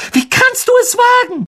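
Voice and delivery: very high outraged shriek. A narrator's reading. Alicia shrieking at Malfoy in outrage. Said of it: "Alicia kreischte".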